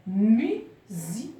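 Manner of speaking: MUSIQUE very slowly as MU, SI, QUE; syllable by syllable. Shown as a demonstration. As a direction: break Musique into spaced beats, voicing the final que. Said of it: "Au début du mot".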